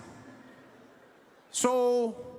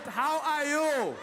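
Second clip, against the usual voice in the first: slow insistent greeting; much slower than usual. Acted. Negotiator repeats the question slowly.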